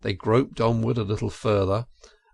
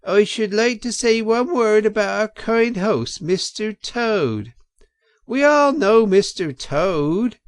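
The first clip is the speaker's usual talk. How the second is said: mocking weasel speech; 10 semitones higher, 5 dB louder than usual. A narrator's reading. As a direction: drag out the mockery of Toad. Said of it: "a voice could be made out saying".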